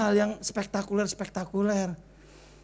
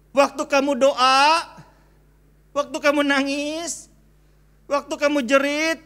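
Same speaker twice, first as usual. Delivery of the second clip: higher and louder, slow at first; rising sermon intensity; building preaching; 4 semitones higher, 5 dB louder than usual. Acted. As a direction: lift the congregation toward the promise. Preaching build before quote.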